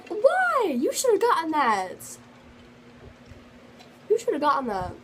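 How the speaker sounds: very high why, then loud protest; shocked friends. Retold or imitated. Friends hearing she failed, shocked high protest. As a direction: imitate friends outraged for her. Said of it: "And they're like".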